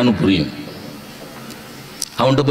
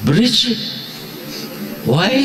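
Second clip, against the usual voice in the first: higher, rising mock surprise; puzzled question; 6 semitones higher, 4 dB quieter than usual. Teacher playing a puzzled listener in the bridge joke, higher and rising. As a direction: ask it in amused disbelief.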